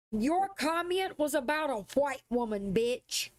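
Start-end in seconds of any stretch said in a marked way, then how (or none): none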